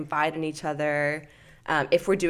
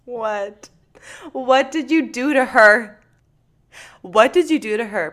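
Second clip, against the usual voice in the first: alarmed; 6 semitones higher, 7 dB louder than usual. Real, not acted. Alarmed question about friend.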